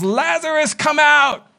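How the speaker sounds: high, loud summons ringing out; loud call. A narrator's reading. Jesus calls dead Lazarus out of the tomb, a loud high summons. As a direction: call him out of the tomb with full voice. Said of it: "in a loud voice".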